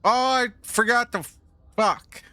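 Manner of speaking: sudden high, loud curse; angry outburst. Real, not acted. Streamer forgets something in the game, high loud outburst. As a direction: blurt it out, furious at yourself. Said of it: "I'm upset".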